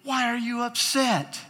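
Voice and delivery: high-pitched, probing question; God questioning Jonah. Retold or imitated. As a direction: press the question as God, acted out. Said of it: "and God says".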